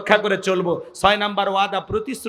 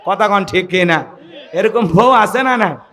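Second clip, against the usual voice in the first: normal talking voice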